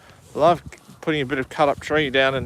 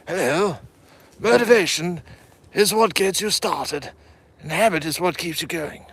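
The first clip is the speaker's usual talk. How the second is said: James Mason impression; 6 semitones higher than usual. Shown as a demonstration. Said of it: "James Mason impression".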